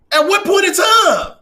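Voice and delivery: exasperated demand. Real, not acted. Commentator challenging people who won't admit a point, exasperated. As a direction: push the question up and out, exasperated. Indignant rhetorical question repeated for emphasis.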